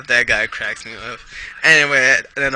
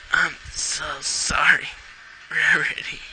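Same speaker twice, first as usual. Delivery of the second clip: tearful apology; 3 semitones higher than usual. A narrator's reading. Grieving pony apologising to her injured friend, tearful. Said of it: "Small tears worked their way down".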